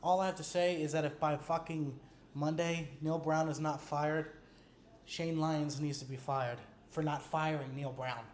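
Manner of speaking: quiet, held-back rage, words pushed out low; barely holding it together, keep it down; trying to stay composed. Real, not acted. Fan telling viewers the coach must go, restrained and quiet. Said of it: "I'm really gonna try and keep my, composure".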